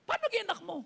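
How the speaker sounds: high-pitched puzzled disbelief; retold baffled question, pitched high; incredulous. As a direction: demand an explanation in mock astonishment. Acted disbelief at learning he's father.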